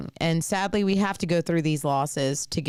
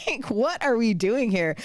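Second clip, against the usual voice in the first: high mock outburst of disbelief; imitating own stunned reaction; retold disbelief; 7 semitones higher than usual. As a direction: replay your shocked reaction with a laugh. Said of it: "I was like".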